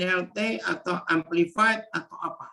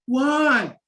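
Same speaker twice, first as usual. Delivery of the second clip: usual speaking voice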